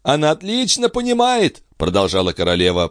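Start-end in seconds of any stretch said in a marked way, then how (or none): 0.0-1.6 high, pained agitation; agitated. A narrator's reading. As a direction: work yourself up through the pain. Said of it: "приходя всё в большее возбуждение от собственной боли". (взволнованно, высоким голосом)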